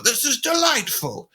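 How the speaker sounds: put-on a new dad marvelling at his baby's laugh, slow and delighted. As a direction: savour the moment slowly. Voicing delight at baby laughing.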